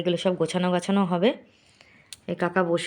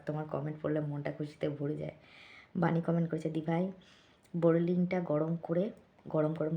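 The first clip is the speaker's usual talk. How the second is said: hushed talking; 9 dB quieter than usual. Real, not acted. Vlogger saying good night to viewers while kaka sleeps next door, hushed. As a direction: keep it very soft, someone's sleeping next door. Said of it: "এই জন্য আস্তে আস্তে কথা বলছি".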